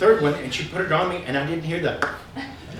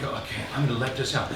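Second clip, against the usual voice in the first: quiet resolve; 9 semitones lower, 12 dB quieter, faster than usual. Retold or imitated. Self quoted before exhale.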